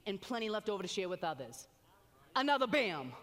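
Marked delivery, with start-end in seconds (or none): 2.3-3.1 louder punchy exclamation; excited exclamation. Acted. Preacher to the congregation, a loud excited bam. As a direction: punch it out like a win.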